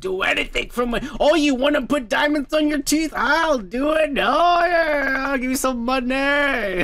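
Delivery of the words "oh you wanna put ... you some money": mock rich guy. Mocking a rich guy's offer.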